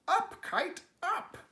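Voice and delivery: high, loud cheering shout. Acted. Frog showing Toad how to shout the kite up, high and loud. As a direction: yell it up at the sky. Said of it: "and shout".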